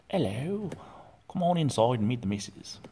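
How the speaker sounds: lightly done Cockney host; mock Cockney accent. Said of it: "the Cockney accent".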